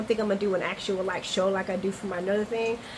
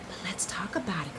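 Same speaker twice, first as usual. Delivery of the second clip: much softer, quick whisper; whispered aside; 3 semitones lower, 10 dB quieter, faster than usual. Real, not acted. Host telling viewers let's dish, in a quick whisper. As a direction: drop to a conspiratorial whisper.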